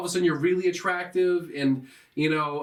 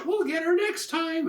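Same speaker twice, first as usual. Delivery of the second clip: high-pitched cutesy mock voice; cute girl; 13 semitones higher than usual. Cupid girl consoles the rejected guy, mock cutesy. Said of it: "the cute girl's like".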